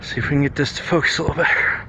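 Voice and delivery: out of breath from the uphill heat; huffing and puffing between words. Real, not acted. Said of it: "I know I'm huffing and puffing".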